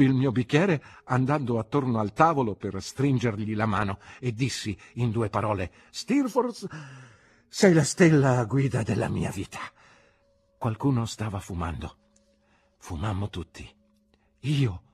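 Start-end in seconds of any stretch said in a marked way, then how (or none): 5.9-9.6 tipsy gushing. A narrator's reading. Tipsy host gushing to his friend.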